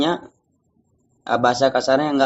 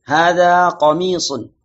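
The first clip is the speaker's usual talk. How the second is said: slow, stretched model sentence; drawn-out vowels, falling close; reading for repetition; 3 semitones higher, 4 dB louder, slower than usual. Shown as a demonstration. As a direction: read it out slowly for the class to echo. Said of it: "Baik, kita baca bersama".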